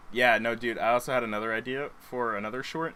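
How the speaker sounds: ordinary talk, nothing marked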